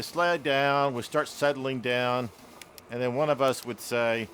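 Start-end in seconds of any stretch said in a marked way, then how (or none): none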